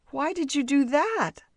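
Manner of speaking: high, loud dismayed cry; a servant shocked his calabash broke. A narrator's reading. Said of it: "asked the servant in dismay".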